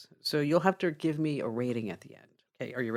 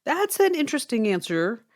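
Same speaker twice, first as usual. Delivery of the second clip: higher-pitched, hesitant puzzlement; taken aback, pausing to think; surprised policeman; 8 semitones higher, 4 dB louder than usual. Acted. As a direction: stall in acted surprise. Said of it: "the policeman's surprised at this".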